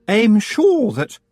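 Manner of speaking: high, sugary-polite rival witch; sweet on top, a knife underneath; Missus Earwig. Acted. Earwig answers.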